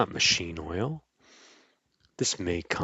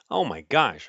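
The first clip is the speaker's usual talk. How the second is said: plain reading voice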